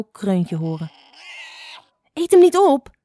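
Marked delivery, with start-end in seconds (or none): 2.1-3.0 high, loud alarm; shrieked little-bird protest; worried cry. A narrator's reading. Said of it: "riep Spotlijster bezorgd".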